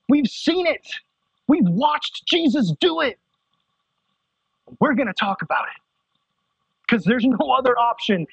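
high, driving preacher's voice, pushing each line; full-force preaching. Real, not acted. Pastor urging the youth group, full-force preaching.

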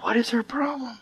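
hushed aside among classmates; judgmental whisper. Retold or imitated. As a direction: whisper it, puzzled and critical. Classmates whispering about the girl.

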